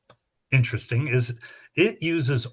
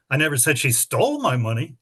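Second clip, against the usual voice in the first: "stole" stretched and pitched up; stress on stole. Shown as a demonstration. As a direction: hit the word stole. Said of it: "read that sentence with seven different emphases".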